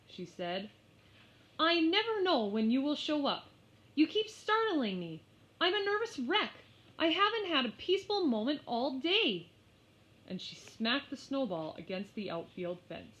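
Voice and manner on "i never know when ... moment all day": frazzled. Acted. Mary venting frustration.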